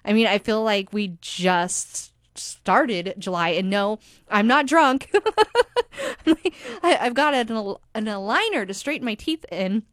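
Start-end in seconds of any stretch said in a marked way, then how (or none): 5.1-6.2 sudden high-pitched giggle. Real, not acted. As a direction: burst into a high little giggle. Laughs about sounding drunk.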